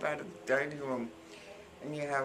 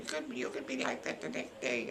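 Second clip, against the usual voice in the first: higher teasing warning; warning friend; 13 semitones higher than usual. Retold or imitated. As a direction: wag a finger at her. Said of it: "Jane's always telling me".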